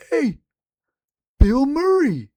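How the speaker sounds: high-pitched, drawn-out mock Hawaiian accent; muttered Hawaiian accent impression. Storyteller imitating stranger at gas pumps, mock Hawaiian accent. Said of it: "talking under his breath in a Hawaiian accent".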